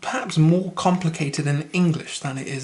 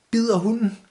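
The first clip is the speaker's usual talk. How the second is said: "bider hunden" joined in one higher phrase; phrased as question; 6 semitones higher than usual. Shown as a demonstration. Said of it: "phrase this the right word".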